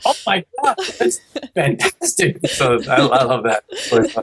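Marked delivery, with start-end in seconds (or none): 0.1-2.5 hammed-up thrilled owner; amazed delight. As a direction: gush over the dog's first words. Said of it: "You're like".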